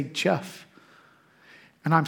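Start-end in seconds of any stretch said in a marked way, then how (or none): 0.0-0.7 gentle quiet calling of Jeff; a tender one-word call; soft name call. Pretend. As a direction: say Jeff softly, rising at the end. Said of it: "all he has to do is say".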